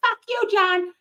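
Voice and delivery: high-pitched imitation of an angry wife; mock Karen. Karen cursing John as she drives off, high. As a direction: fling the curse in a high voice. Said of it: "she's like".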